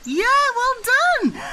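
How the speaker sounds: high, slow, delighted praise; excited praise. Real, not acted. Praising child's sheep sound.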